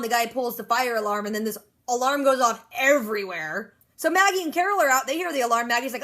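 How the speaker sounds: ordinary talk, nothing marked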